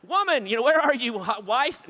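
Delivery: loud, high call to his wife. Retold or imitated. Neighbor calling for his wife to help, loud and high. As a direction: shout across the house for the wife.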